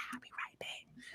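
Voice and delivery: secretive whisper. Retold or imitated. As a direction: slip away whispering to the friend. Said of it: "I said".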